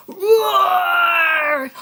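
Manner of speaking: loud, high playful roar; bear roar. Pretend. Playing Brownie Bear for a child, loud roar. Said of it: "Brownie Bear says".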